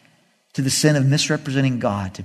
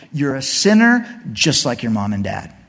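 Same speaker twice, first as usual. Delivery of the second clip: normal talking voice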